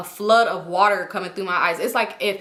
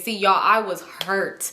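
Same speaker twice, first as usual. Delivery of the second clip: normal talking voice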